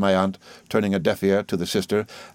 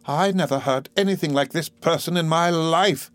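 higher, indignant Miss Murdstone; indignant exclaim; 9 semitones higher than usual. A narrator's reading. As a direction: exclaim in prim outrage. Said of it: "exclaimed Miss Murdstone".

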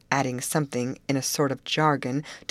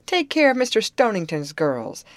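raised, higher calling voice; 6 semitones higher, 5 dB louder than usual. A narrator's reading. Contractor calls to his wife about the girls, calling out. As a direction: call an instruction across to someone. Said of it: "he called".

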